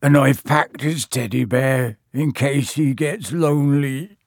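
slow, muffled, tearful voice; Hagrid. A narrator's reading. Hagrid packing Norbert's teddy bear, muffled and tearful. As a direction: choke it out, doting and sad. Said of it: "said Hagrid in a muffled voice".

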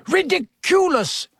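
very high yell, volume contained; Dean. A narrator's reading. Pupil facing a crawling hand, high yell. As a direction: yell the spell with pitch leaping up. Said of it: "yelled Dean".

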